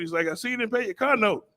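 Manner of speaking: ordinary delivery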